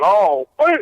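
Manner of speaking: loud excited shout; yelled catchphrase. Retold or imitated. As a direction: yell it out at a show. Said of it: "I'll yell out".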